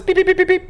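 short high beeps; imitated warning beeps. Conscience alerts someone who did wrong, imitated beeping.

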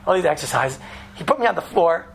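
normal talking voice

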